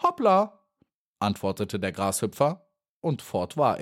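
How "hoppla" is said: Hoppla. Acted. Grasshopper leaps away.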